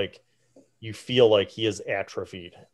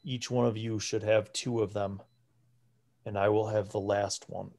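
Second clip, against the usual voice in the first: put-on frail old priest. Frail priest questions the party about the ritual, hushed. Priest assigns candle holders.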